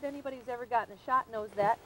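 usual speaking voice

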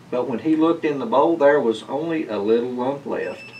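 usual speaking voice

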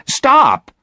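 sharp, high, loud cry to halt; indignant high yell. A narrator's reading. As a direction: cut in with a high, loud outburst. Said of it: "cried Virginia".

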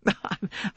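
little high self-conscious titter; self-conscious laugh. A narrator's reading. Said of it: "her little self-conscious laugh".

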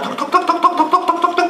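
high rattled-off talk talk talk; mock the nonstop gossip; mocking chatter. A priest mocking mourners who talk through a wake, high rattling.

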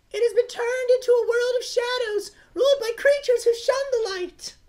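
high, grave storybook princess voice; solemn princess narration. Acted. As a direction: tell the legend like a noble princess. Reads game intro as princess.

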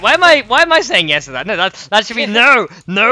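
giddy loud protest at yourself; flustered. Real, not acted. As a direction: catch yourself and backpedal loudly. Laughing self-correction.

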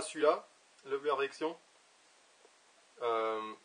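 talking around pipe stem; pipe in mouth. Real, not acted. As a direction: teeth clenched on pipe. Seen 4: Pipe stem held between teeth from +0.6 while speaking.

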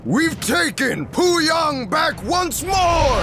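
slow, high triumphant cry; victory shout. Acted. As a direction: proclaim the win to all. Victory announcement after battle.

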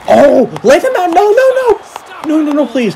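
very high, loud pleading yelps; panicked shriek. Real, not acted. Gamer yelling at his screen as the opponent shoots, panicked pleading shriek. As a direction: beg the goalie to stop it, in real panic.

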